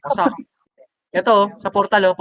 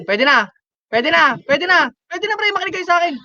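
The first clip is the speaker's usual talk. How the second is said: excited; 9 semitones higher than usual. Real, not acted. Teammates can push now, shotcalling.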